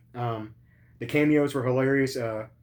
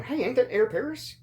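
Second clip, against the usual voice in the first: much higher mock surprised question; imitating Luke Gallows; 11 semitones higher than usual. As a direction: toss it up high like a surprised wrestler. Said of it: "and then Luke Gallows going".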